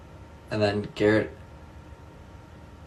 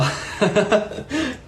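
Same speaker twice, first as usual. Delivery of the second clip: a high, bright burst of laughter; 11 semitones higher than usual. Real, not acted. Streamer laughing at a chat comment, high giggle. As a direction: let a squeaky giggle escape.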